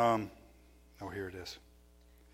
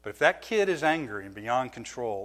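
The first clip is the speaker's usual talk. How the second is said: ordinary talk, nothing marked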